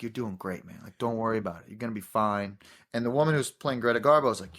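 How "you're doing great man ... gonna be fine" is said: low, soft, calming encouragement; soothing reassurance. Retold or imitated. Gary in the back seat calming the driver, soft reassurance. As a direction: ease a nervous actor down gently.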